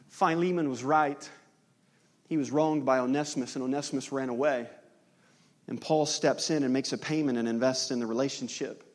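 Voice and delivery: ordinary talk, nothing marked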